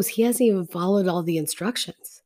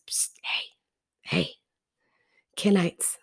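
hushed psst to get their attention; secretive whisper; 7 dB quieter than usual. Retold or imitated. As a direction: whisper furtively, as if tipping someone off. Sneaky aside to the Kenites.

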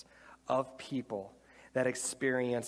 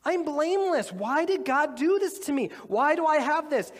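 anguished cry; 12 semitones higher, 6 dB louder than usual. Acted. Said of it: "And Job's like".

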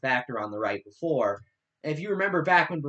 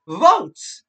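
loud, higher mocking blurt; Rush Limbaugh parody; 5 semitones higher, 10 dB louder than usual. Podcast host reading a radio host's transcript, mocking his voice. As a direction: punch it out as a mock radio host. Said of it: "making fun of his voice".

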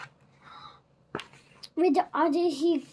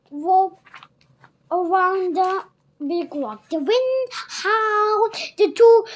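usual speaking voice

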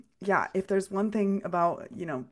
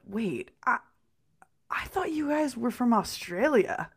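mildly puzzled retold question, higher at the end; confused booth worker; 5 semitones higher than usual. Booth worker puzzled by two supposed Australians, imitated confusion. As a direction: imitate someone hesitantly working it out. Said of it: "looking at us so confused".

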